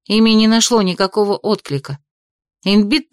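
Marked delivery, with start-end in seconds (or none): none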